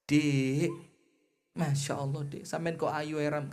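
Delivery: rayuan lembut dan pelan; sweet talk in her ear. Retold or imitated. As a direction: bisikkan pujian di telinga istri. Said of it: "ngomong ke istrinya di telinganya". (suami merayu istri dengan lembut di telinganya)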